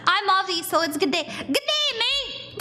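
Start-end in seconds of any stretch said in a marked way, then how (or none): 1.2-2.6 high, hammed-up Aussie greeting; amateur Aussie twang; awful Australian accent. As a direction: attempt a bad Australian accent, pitched way up. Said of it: "I feel like that was awful".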